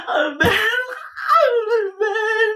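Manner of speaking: high wail of a lover's name; anguished calling out. Acted. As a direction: cry out for the one who left. Calling after boyfriend who hung up.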